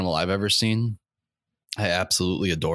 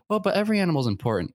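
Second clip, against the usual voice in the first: mock objecting voice; 10 semitones higher, much faster than usual. Imagined opponent in the argument.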